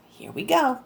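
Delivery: whispered, no voice at all. Shown as a demonstration. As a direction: breathe it like a secret. Reader whispers the repeat.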